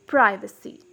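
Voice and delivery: "privacy" with a long I; American "privacy", first vowel as in "eye"; American pronunciation. Shown as a demonstration. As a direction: say "privacy" the American way, as PRY-vuh-see. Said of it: "in American accent".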